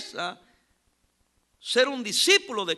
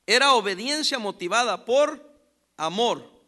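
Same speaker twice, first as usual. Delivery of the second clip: slow, deliberate and louder; emphatic repeat; 7 dB louder, slower than usual. Shown as a demonstration. Preacher repeating the key phrase, slow and emphatic. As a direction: spell it out word by word. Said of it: "Oiga, voy a repetir".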